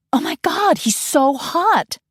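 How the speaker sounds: put-on very high, slow squeal of a star-struck girl; gushing valley girl. California girl swooning at the stripper, very high squeal. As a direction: swoon over him like a giddy valley girl.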